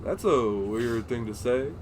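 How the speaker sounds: slow, low Obama voice; drawled Obama-like pauses; Obama impression. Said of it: "starting to sound like my Obama".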